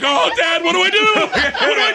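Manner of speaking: high, laughing mock panic. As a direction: squeal for help to dad, cracking up. Panicked son asks his dad.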